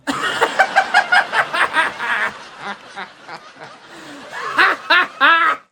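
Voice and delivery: loud, high, long burst of real laughter; big cackling fit that fades and bursts back; laughing hard. Real, not acted. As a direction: crack up uncontrollably at your own joke. Cracking up at own story.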